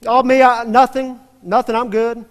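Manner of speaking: high, loud, caught-out stammer; flustered denial. Retold or imitated. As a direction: blurt it like a caught kid. Rabbi caught hiding, flustered denial.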